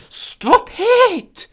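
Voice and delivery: very high, loud stop it!; shrieked protest. Real, not acted. Player shrieking at the game to stop recording. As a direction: shriek at the machine.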